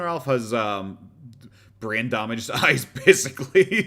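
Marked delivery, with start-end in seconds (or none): 1.8-3.9 slow words breaking into loud laughter; laughing. Real, not acted. Laughs while describing the eyes.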